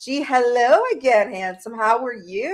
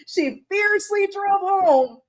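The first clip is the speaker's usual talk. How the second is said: laughing tease; 10 semitones higher, slower than usual. Real, not acted. Teasing friend, laughing.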